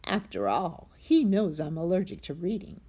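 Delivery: plain reading voice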